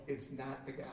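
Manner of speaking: softly retold timid correction; meek aside. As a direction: say it small, from behind her shoulder. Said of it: "I'm kinda meekly hovering behind her".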